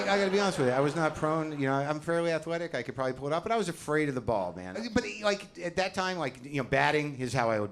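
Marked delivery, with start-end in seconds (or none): none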